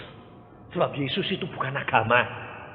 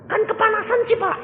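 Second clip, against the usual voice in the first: whining complaint; 6 dB louder than usual. Acted. Acted complaint about oven heat.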